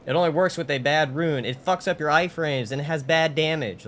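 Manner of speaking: high, emphatic hype; excited performance. Shown as a demonstration. Streamer demonstrating a scripted video performance, excited. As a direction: pitch it up and punch the key words. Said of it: "you emphasize things more".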